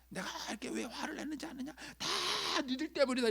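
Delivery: high, strained scolding, building loud; angry father. Acted. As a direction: blame the children in an acted outburst. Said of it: "아버지가 화를 내는데". (화난 아버지처럼 높고 크게 호통)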